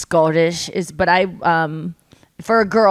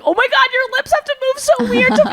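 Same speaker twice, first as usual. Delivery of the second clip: much higher, excited voice; amazed realization; 4 dB louder, faster than usual. Retold or imitated. Podcaster discovering his lisp, high and amazed. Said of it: "And he was like".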